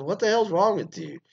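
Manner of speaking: imitating a wife scolding her husband; mock disgusted scold. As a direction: throw it at him in disgust.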